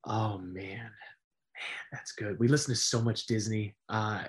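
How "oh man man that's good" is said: soft whispered musing; real whisper of thought. Real, not acted. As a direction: breathe it out while thinking. Stumped by the question.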